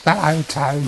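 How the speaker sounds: a high, cheerful mock phone voice; girl answering the phone. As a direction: imitate the young woman picking up the office phone. Imitating Emma answering the phone.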